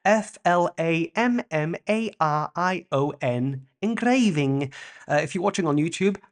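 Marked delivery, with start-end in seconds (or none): 0.1-3.7 slow letter-by-letter spelling; careful slow spelling; spelled out. Shown as a demonstration. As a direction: spell it clearly for the listener. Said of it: "search for the image".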